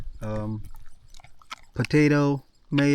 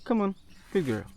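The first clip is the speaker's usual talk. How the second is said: higher coaxing call; coaxing a dog; 8 semitones higher, faster than usual. Real, not acted. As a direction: coax her over, pitched up. Calling the dog over.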